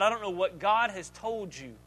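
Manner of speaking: normal talking voice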